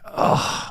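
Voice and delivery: soft, breath-heavy groan of exhaustion; weary groan. Acted. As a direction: let out a drained sigh into your hands. Exhausted collapse, head in hands.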